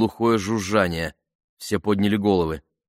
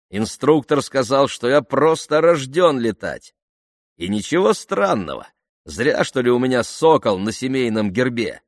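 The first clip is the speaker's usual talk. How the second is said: higher, lively, showing off; exuberant boasting; 5 semitones higher, 3 dB louder than usual. A narrator's reading. Jeremy boasting to Ben about flying, exuberant boasting. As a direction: brag with breezy confidence.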